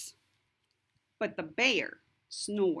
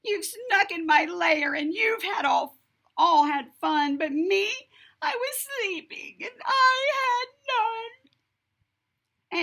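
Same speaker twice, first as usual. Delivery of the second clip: high, loud whimpering complaint; wailing bear; 11 semitones higher, 5 dB louder than usual. Acted. Bear crying to the animals who partied without him. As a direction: whine like a hurt child.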